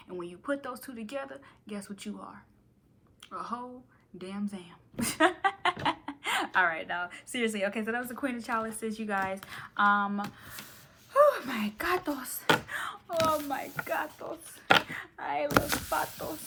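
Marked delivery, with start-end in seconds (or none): 4.7-6.5 big high laugh bursting out; loud burst of laughter. Real, not acted. Laughing at her own joke to viewers, loud high laugh. 11.1-16.4 playful catchphrase. Pretend. Playful sing-song catchphrase.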